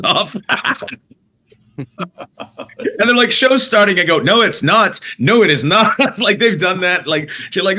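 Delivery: laughing, pitched high, mock insistent no; laughing retelling. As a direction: laugh through the staff's call and your defiant reply. Arguing with venue staff, laughing.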